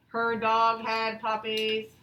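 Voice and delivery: slow, dull, bored reading; flat and bored. Shown as a demonstration. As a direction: read it with no excitement. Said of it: "Should I read it like that?".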